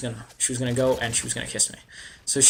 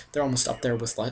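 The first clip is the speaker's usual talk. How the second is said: ordinary talk, nothing marked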